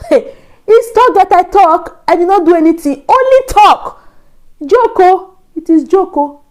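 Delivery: high, loud, laughing disbelief; exasperated protest. Real, not acted. Narrator protesting to viewers it was only a joke, high exasperated.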